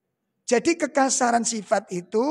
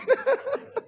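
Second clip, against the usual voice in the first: high laugh. Real, not acted. Joking about the noisy wives.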